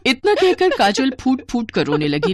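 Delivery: usual speaking voice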